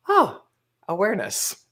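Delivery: ordinary talk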